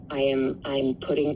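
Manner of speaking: ordinary delivery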